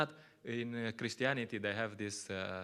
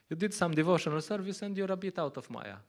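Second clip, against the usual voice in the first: ordinary talk, nothing marked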